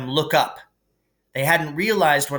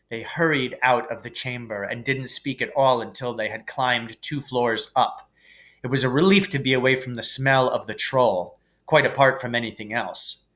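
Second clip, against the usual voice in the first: ordinary delivery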